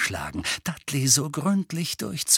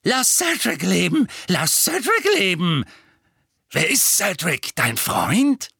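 high, pointed whine; taunting cry-baby imitation; mocking whimper; 8 semitones higher, 4 dB louder than usual. A narrator's reading. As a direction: mimic his pleading in a wimpering squeal. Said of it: "nahm eine spitze, wimmernde Stimme an".

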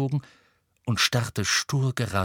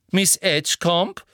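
high, insistent Umbridge voice; prompt Umbridge; 8 semitones higher, 4 dB louder than usual. A narrator's reading. Umbridge turns at once to her witness, insistent. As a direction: call her name at once, pitched up. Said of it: "sagte Umbridge sofort".

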